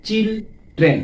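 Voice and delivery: চিল, then train, as two separate beats; teacher sounding out চিল ... train piece by piece; split into syllables. Shown as a demonstration. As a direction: leave a clear gap between চিল and train. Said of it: "দুইবারে উচ্চারিত হচ্ছে".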